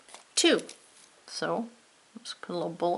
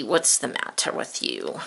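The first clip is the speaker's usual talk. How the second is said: much lower, gruff cartoon pirate asking back; 13 semitones lower than usual. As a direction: drop way down into a pirate voice. Pirate echoes teacher's question.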